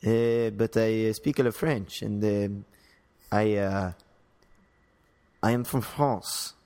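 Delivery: halting English with a French accent. Shown as a demonstration. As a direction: play someone from France speaking English. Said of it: "a French accent".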